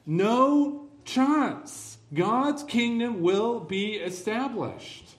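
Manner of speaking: defiant pulpit crescendo; preaching at full force. Real, not acted. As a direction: drive the promise home to the congregation, rising to a loud peak.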